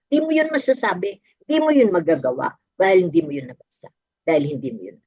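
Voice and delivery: quick, pressing repetition; rapid emphatic build. Acted. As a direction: drive it home quickly. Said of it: "fast emphatic preaching build". (mabilis at madiing pagsasalita)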